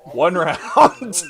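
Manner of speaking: loud, high burst through laughter; laughing. Real, not acted. DM telling players when elite troops arrive, laughing. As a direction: crack up loudly while saying it. DM cracks up while answering.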